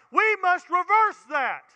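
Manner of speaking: "reverse" driven home slowly; emphatic preaching. Acted. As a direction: hammer "reverse". Preaching climax repeated.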